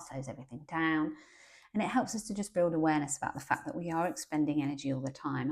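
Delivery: ordinary talk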